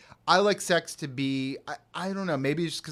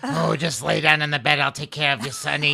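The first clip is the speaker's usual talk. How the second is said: put-on louder, creepy old man tone; dirty old man voice; 7 dB louder than usual. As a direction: purr it like a sleazy old man. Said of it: "dirty old man voice playing in my head".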